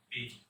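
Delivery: বেরি said with an 'e' in the first syllable; correct pronunciation. Shown as a demonstration. Teacher showing learners the word, correct pronunciation. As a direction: model বেরি crisply for learners. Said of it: "উচ্চারণ করবো".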